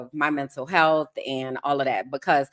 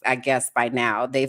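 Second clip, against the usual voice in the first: ordinary talk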